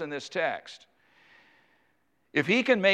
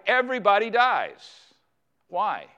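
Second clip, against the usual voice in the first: emphatic declaration; 5 semitones higher, 4 dB louder than usual. Acted. Preacher driving home his point.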